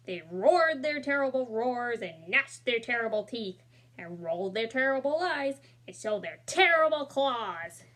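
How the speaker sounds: ordinary delivery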